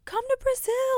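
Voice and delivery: squeaky high mock plea; fan comment meme voice. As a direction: sing it like a begging fan. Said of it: "people always comment on".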